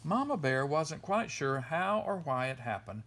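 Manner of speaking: usual speaking voice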